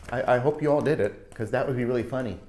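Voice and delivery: usual speaking voice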